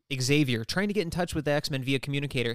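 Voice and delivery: usual speaking voice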